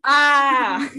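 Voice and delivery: high, loud, hammy battle cry; battle scream. Retold or imitated. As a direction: belt out a fake heroic scream. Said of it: "and I scream to the top of the sky".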